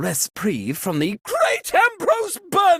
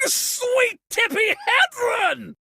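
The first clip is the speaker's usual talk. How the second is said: startled cry; 14 semitones higher than usual. Acted. Host startled mid-sentence.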